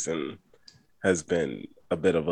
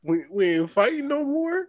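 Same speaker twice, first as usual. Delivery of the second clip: squeaky high joke voice; high mock voice. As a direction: pitch it way up for the joke.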